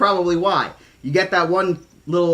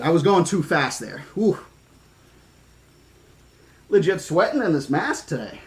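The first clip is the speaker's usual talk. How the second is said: ordinary delivery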